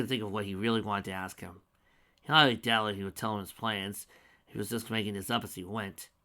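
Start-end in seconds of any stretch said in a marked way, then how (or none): none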